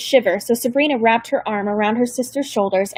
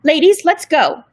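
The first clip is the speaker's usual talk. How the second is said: loud, higher barked command; stern order; 5 semitones higher, 5 dB louder than usual. A narrator's reading. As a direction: caseworker hustling the girls off the train, loud order. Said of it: "Miss Smirt ordered".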